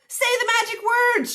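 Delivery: very high, loud command; urgent storybook yell; frantic shout. A narrator's reading. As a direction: shout it over the chaos. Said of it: "shouted Moo".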